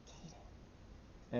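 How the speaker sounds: hushed mock-mom reproach; retold reproach, barely voiced; imitated disapproving mom. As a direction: say the name almost voiceless, as mom. Voices mother's disapproving use of name.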